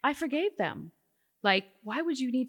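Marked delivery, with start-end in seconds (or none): 0.0-0.9 matter-of-fact. Retold or imitated. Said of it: "matter-of-factly".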